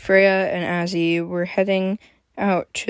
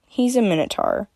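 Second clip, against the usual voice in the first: ordinary delivery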